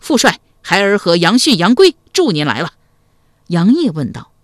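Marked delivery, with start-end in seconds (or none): 0.0-2.7 higher, louder hail to a commander; loud call. A narrator's reading. Son arriving with troops to aid his father, loud rallying call. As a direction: call out that help has arrived. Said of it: "杨延普高声叫道".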